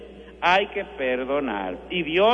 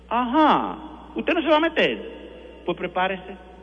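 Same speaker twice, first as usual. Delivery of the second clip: mocking challenge, pitched up; scolding the parishioners with irony; challenging; 4 semitones higher than usual. Real, not acted. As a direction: call out the listener teasingly. Priest challenges the listener.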